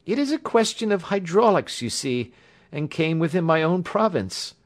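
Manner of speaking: high-pitched voice, earnestly recounting; injured young engineer. A narrator's reading. Patient telling the doctor how he bound his wound, earnest.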